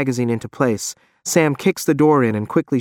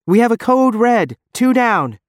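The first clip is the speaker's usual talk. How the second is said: urgent radio call; 11 semitones higher, 4 dB louder than usual. Acted. Janice over radio in combat.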